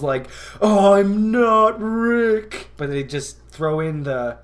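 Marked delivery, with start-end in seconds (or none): 0.6-2.7 high, slow mock yell. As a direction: belt it out slowly. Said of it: "show just yells".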